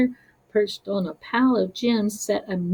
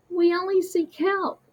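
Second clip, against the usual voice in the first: polite; 10 semitones higher than usual. Acted. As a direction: ask humbly for help. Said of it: "they explained politely".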